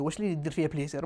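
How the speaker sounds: low, soft sotto voce grumble; muttered aside. Retold or imitated. Patient grumbling privately at doctor's odd remark, muttered aside. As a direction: drop low and quiet, as if to yourself. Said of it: "توسوس له".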